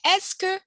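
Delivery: teacher stresses "Est-ce que"; emphasized Est-ce que. Shown as a demonstration. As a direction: punch "Est-ce que" high. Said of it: "You say it first".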